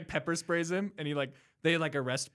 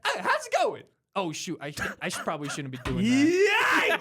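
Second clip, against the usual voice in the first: Donkey voice; 3 semitones higher, 5 dB louder than usual. Retold or imitated. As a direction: play the excitable cartoon donkey.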